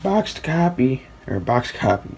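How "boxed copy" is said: voice crack. Real, not acted. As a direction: voice cracking up high, slowly. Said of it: "sorry for the voice crack".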